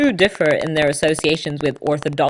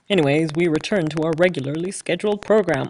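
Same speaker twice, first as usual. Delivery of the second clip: lightly put-on announcer voice signing back in; a hint of the fake ad-read accent; mock announcer accent; faster than usual. Podcaster returns from the fake break, lightly put-on announcer voice. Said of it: "don't know what that accent is".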